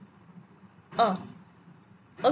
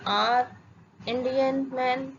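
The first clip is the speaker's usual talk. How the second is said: slow example; much slower than usual. Shown as a demonstration. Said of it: "चलो अब कुछ examples देख लेते हैं।".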